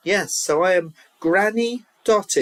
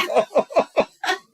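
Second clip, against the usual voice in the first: giggling laugh; 10 semitones higher than usual. Real, not acted. Laughing at own slip.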